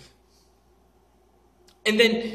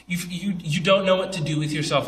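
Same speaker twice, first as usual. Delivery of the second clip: ordinary talk, nothing marked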